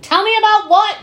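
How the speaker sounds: high, loud mock outburst; exasperated demand. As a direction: burst in over the agents, loud and indignant. Acted angry client interrupting agents.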